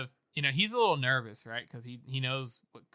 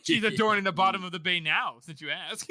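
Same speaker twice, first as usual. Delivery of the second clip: raised, louder cartoonish fibbing; unconvincing liar; 4 semitones higher, 6 dB louder than usual. He elaborates his cover story, mock bad lying. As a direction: overplay a badly told cover story. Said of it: "sounds like a Scooby-Doo character trying not to lie".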